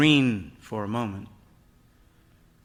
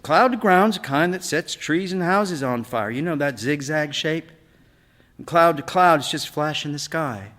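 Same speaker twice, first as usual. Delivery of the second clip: put-on young boy, higher and louder, eager to explain; boy's voice, pitched up, lecturing proudly; boy Eric explaining; 6 semitones higher, 7 dB louder than usual. A narrator's reading. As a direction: play the son showing what he learned. Eric explains to his father.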